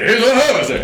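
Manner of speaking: high, loud stand-up comic voice; Bernie Mac. Acted. Co-host riffing on a nineties comedy act, high shouted impression. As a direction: shout the line like a comedian on stage. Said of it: "I would go see, like, Bernie Mac".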